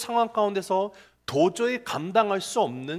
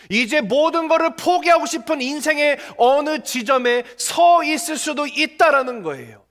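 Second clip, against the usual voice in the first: louder, higher, impassioned sermon delivery; full-force preaching; 5 semitones higher, 6 dB louder than usual. Acted. Pastor urging the struggling congregation, at full force. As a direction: pastor pleading with the congregation, rising fervor.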